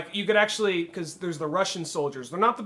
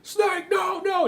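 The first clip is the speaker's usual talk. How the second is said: high loud cry; mock anguished shout; 13 semitones higher, 5 dB louder, much slower than usual. Meryl screaming at Snake, a high loud cry. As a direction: scream no in despair. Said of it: "like, I hear".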